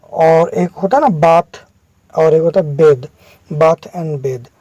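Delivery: usual speaking voice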